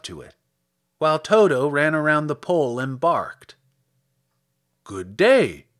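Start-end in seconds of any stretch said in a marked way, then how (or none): 4.8-5.7 low, loud husky greeting; a rather husky scarecrow's "good day". A narrator's reading. Scarecrow greets the girl from his pole, husky. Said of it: "in a rather husky voice".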